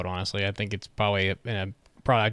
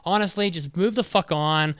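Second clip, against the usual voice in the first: higher, exasperated rant; fed-up rant; 6 semitones higher than usual. Real, not acted. Reviewer ranting to co-host, higher exasperated tone. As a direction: vent your frustration, pitched up. Ranting about politics in anime.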